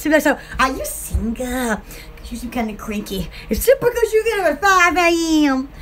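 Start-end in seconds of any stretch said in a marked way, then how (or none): 0.2-3.3 pilot chatting up. Acted. Pilot voiced, chatting her up.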